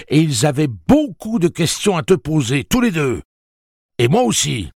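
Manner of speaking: lightly voiced furious father; angry father. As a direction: press the son hard. Father demands answers.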